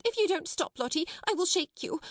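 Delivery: high, exasperated scolding; cross threat. A narrator's reading. Miss Amelia losing patience with Lottie, threatening. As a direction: switch abruptly to threatening the child. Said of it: "then in quite another tone".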